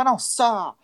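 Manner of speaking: mock-indignant young radical; mock indignant retort. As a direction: play your younger self snapping back. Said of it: "Eu falava".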